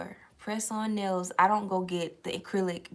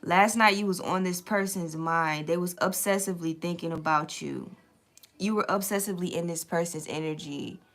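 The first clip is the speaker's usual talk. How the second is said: normal talking voice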